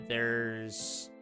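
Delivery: usual speaking voice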